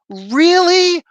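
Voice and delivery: high, loud disbelieving really; incredulous. Real, not acted. Reviewer shrieks in disbelief at the writing choice. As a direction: burst out in disbelief.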